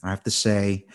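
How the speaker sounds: normal talking voice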